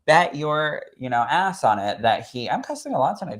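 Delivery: normal talking voice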